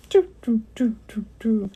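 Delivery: a hammed-up Pac-Man losing sound; mock falling-score jingle. Telling viewers their score sinks, hammed-up doo doos. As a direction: sing the doo doos dropping like a game.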